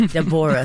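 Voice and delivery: "Deborah" as de-BOR-ah; three syllables. Shown as a demonstration. Actress insisting on her character's name, "Deborah" said de-BOR-ah. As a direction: stress the middle of "Deborah", three full syllables. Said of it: "three syllables".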